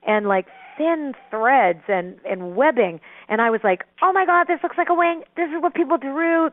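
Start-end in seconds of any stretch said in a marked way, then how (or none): 4.0-6.5 much higher, breathless amazement; high-pitched retold awe; awed wonder. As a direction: marvel at it like a revelation. Marvelling at the angel's wing.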